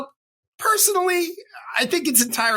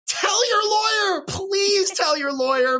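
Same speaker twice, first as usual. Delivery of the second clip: worked up; 10 semitones higher, slower than usual. Real, not acted. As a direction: hammer it home, begging clients. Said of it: "I'm a little worked up".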